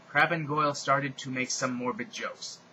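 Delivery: usual speaking voice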